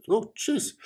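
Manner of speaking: jumps high on oh jeez; a stunned little oh jeez; surprised gasp. Acted. Second guy reacts to fortune.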